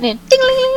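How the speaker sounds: high ringing "ding-a-ling"; high-pitched doorbell jingle; bell imitation. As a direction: tinkle like a tiny shop bell. Imitates door bell in scene setting.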